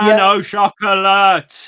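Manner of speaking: over-enunciate it loudly for someone who doesn't speak English; hammed-up loud tourist ordering; mock loud tourist. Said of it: "A bit louder and a bit pointier".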